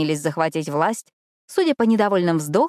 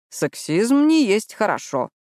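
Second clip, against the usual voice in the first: thoughtful; 4 semitones higher, slower than usual. A narrator's reading. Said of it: "задумчиво сказал Джаспер".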